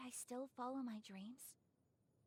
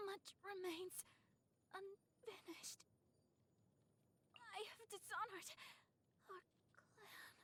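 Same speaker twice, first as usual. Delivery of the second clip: higher, breath fading to nothing; defeated, fading; 8 semitones higher, 5 dB quieter, slower than usual. Acted. Swordswoman falls in battle ashamed, fading weak voice. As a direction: let the strength drain as she falls.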